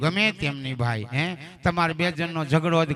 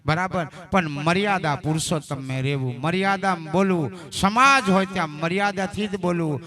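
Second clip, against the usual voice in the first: ordinary delivery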